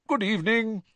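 friendly ghost welcoming students; beaming ghost greeting. Acted. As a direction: greet them with a big smile. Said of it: "beaming at them".

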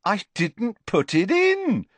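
high, slow, gleeful reveal; grinning broadly. A narrator's reading. Boy revealing his trick to two friends, grinning. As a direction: draw it out through a grin. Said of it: "now grinning broadly".

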